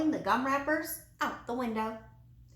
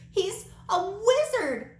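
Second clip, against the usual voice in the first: much higher and louder, slow and breathless; sputtered alarm; 10 semitones higher, 6 dB louder, slower than usual. Acted. Frightened girl running from the wizard, sputtering in alarm. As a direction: blurt it out in wide-eyed shock. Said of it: "she sputtered".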